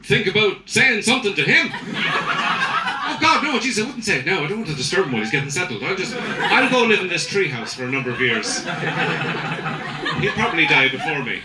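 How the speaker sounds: hammed-up meek Irish toff; unbothered Irish gentleman. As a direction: play the too-polite nation shrugging it off. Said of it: "we just responded as a nation".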